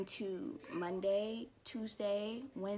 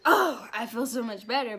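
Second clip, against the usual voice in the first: bright relief; 8 dB louder than usual. Shown as a demonstration. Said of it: "it wasn't like a".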